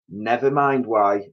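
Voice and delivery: ordinary delivery, nothing marked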